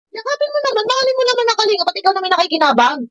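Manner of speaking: very high-pitched, insistent plea; pleading. Real, not acted. Reactor urging Manay to embrace Kalinga, pleading. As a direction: plead with pitch pushed right up.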